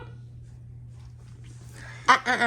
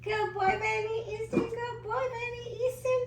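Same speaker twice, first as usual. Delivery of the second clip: climbing ever higher, getting softer; 9 semitones higher, 3 dB quieter than usual. Real, not acted. Praising the baby.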